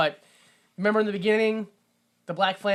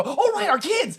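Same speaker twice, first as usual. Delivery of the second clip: sudden remembering; 11 semitones higher than usual. Retold or imitated. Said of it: "all the parents go".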